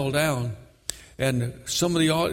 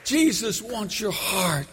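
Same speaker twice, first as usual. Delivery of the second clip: impassioned preaching; 10 semitones higher, slower than usual. Real, not acted. Preacher urging the church to serve God with passion, fervent shout. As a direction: drive it home to the congregation, drawn out and high.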